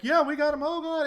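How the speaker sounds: loud, high-pitched mock celebration; theatrical. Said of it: "it's very theatrical".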